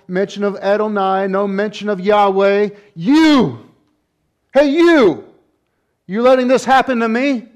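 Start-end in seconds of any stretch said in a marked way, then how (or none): 3.0-7.5 high, loud indignant shouting; Samson's angry shout. Acted. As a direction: shout an accusation upward. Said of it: "a different tone in the voice of Samson".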